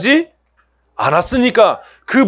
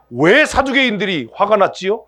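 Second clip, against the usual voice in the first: ordinary talk, nothing marked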